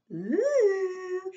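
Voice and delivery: high, long, loud class "ooh"; admiring "ooh". Acted. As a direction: swoon like a whole class impressed. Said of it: "all of room nine said".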